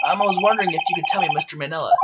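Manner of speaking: ordinary talk, nothing marked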